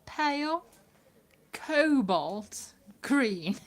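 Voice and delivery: put-on slow, careful posh English accent. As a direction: draw it out like a posh English lady. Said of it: "say it with a posh accent".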